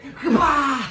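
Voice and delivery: loud, high burst of noise; imitated blast. Imitating Homelander striking a heckler, explosive loud noise. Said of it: "Homelander is just like".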